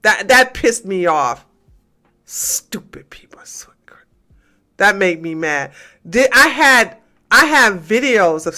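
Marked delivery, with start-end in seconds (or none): 2.2-4.0 whispered under the breath; irritated whispered aside; angry whisper. Real, not acted. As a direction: hiss it to yourself, annoyed. Muttered aside while annoyed.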